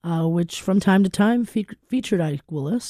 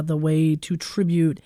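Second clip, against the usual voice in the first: ordinary talk, nothing marked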